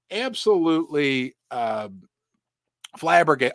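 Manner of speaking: ordinary talk, nothing marked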